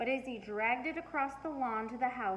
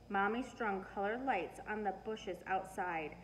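plain reading voice

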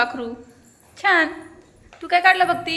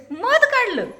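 amused laughing question; 8 semitones higher, slower than usual. Real, not acted. Teacher amused by honey drawing.

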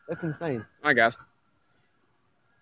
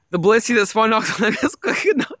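laughing; 5 semitones higher than usual. Real, not acted. Laughing about a Blissey.

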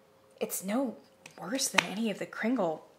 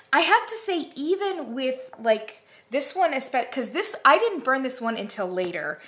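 ordinary talk, nothing marked

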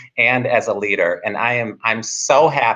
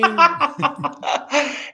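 hearty laugh. Real, not acted. Laughs at host's joke.

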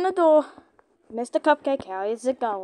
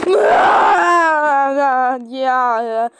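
loud laugh; 7 dB louder than usual. Real, not acted. Laughing after scare.

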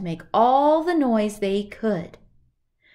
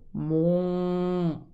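bull moo; 3 semitones lower than usual. Acted. Bull moos.

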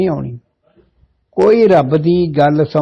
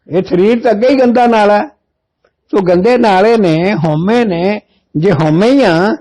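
fervent preaching at a raised pitch; rising preaching; 5 semitones higher than usual. Acted. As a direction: drive the teaching home. Preacher rises to full force. (ਜੋਸ਼ ਨਾਲ ਕਥਾ)

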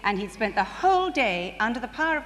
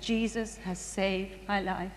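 slow, softer heartfelt testimony; quiet testimony; 3 semitones lower, 6 dB quieter, slower than usual. Retold or imitated. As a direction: let it land slowly, like a humbled man's confession. Tramp testifies at prayer meeting.

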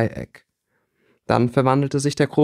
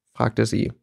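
ordinary delivery

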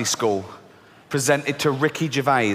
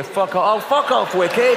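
mock outrage; 4 semitones higher than usual. Mocking the wicked humans.

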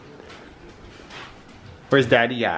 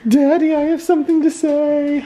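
much higher, louder pretend puppy voice; pretend dog voice; 5 dB louder than usual. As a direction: speak for the dog as a sheepish pup, pitched way up. Owner voices dog apologizing.

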